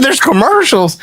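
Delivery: high-pitched disbelieving question; incredulous echo. Retold or imitated. Son learning of dad's ads, retold incredulous echo. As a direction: throw the news back in astonishment. Echoes caller's news in disbelief.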